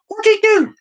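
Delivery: very high, loud comic cry; mock outraged passenger. Passenger after the window is slammed shut, mock outrage. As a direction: squawk the question in put-on shock. Shocked passenger in acted joke.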